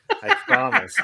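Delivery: high, loud, laughing plea; laughing promise. Real, not acted. Co-host vowing to listen better, laughing. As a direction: squeal it through laughter.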